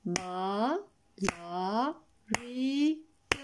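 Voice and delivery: soft, one syllable per beat with pauses; syllables clapped out. Shown as a demonstration. Teacher clapping syllables of "Malawites" for children. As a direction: tap out each syllable softly. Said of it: "Comptez le nombre de syllabes en tapant des mains".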